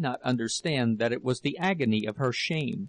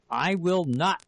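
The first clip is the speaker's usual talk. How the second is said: raised, higher angry refusal; furious shout; 5 semitones higher, slower than usual. A narrator's reading. Husband refusing his clinging wife, shouted in fury. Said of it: "he shouted in fury".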